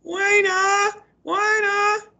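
put-on very high, squeaky little-kid call; toddler voice. Teacher reading, voicing baby sister calling out happily. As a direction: play a toddler calling her sister's name.

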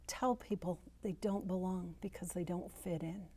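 hushed whisper; whispered aside to the congregation. Real, not acted. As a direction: drop to a breathy whisper.

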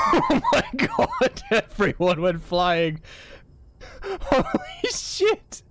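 slow, high, laughing cry; laughing disbelief. Real, not acted. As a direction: squeal through laughter at the chaos. Everyone went flying.

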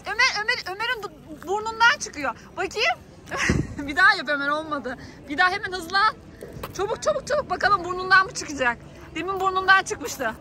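ordinary talk, nothing marked

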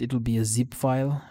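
ordinary talk

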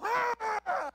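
loud, high explosive yell; huge startling shout; sudden shout. Retold or imitated. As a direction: blast it to scare the dog. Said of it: "I face the dog, and I go".

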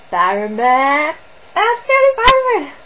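loud, climbing to a high squeal; excited shout. Retold or imitated. Spotting a John Barrowman lookalike, mock excited yell. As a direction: cry it out like a hammed-up starstruck fan.

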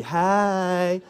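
long, drawn-out hey; showing them how. Shown as a demonstration. Preacher outdoing the ladies' response, exaggerated drawn-out hey. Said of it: "when I can do it better than you".

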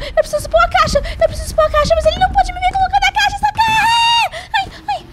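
high frantic cries for help; panicked screaming. Real, not acted. Player chased by a monster, screaming for help.

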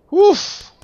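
sharp high loud huff of effort; loud effort grunt. Real, not acted. Player straining at the game, loud high effort grunt. Exertion sound while playing.

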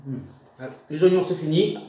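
talking with food in mouth; speaking between bites; chewing while talking. Real, not acted. Seen 0: Onion ring eaten at -2.0; lips pursed as if chewing early on.

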